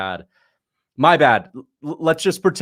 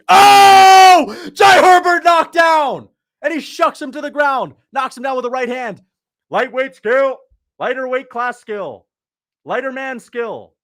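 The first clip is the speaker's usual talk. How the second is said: high, shouted fight commentary, opening at full blast; real excited knockdown call; 13 semitones higher, 12 dB louder, slower than usual. Real, not acted. Streamer calling a live knockdown to viewers, excited shouting. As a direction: erupt at the knockdown, voice staying way up.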